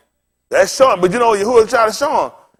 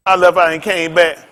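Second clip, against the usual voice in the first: ordinary talk, nothing marked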